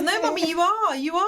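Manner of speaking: a hint of high, childlike protest; imitating insistent daughter. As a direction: insist like a little girl to her mum. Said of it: "She goes".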